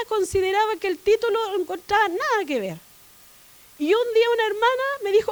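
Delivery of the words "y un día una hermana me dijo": tearful. Real, not acted. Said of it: "cada vez que hablo de él me emociono".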